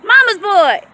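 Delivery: high, loud startled yell; sudden high shout at the dog. Real, not acted. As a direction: shout it out in alarm. Startled in the field.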